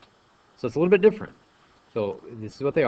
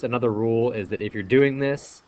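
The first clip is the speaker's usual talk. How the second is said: ordinary delivery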